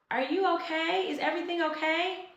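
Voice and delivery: high, gentle concerned stranger's voice; a neighbour calling out, checking she's alright; concerned woman. Retold or imitated. Stranger calling out from behind, a high concerned voice. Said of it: "I hear a voice from behind me".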